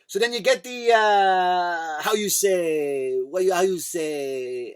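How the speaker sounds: put-on slow, drawn-out French-accented groping for a word; mock French accent. Comedian mimicking her on a cooking show, botched French accent. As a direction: stretch each hesitation in a put-on French accent. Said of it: "Again, I did French accent".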